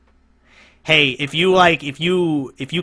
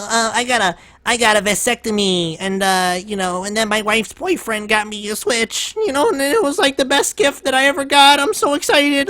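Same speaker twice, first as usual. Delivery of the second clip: much higher, hammed-up gushing fan; mock excited fan; 8 semitones higher than usual. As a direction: parody a gushing Nintendo adult. Mocking a Nintendo fan.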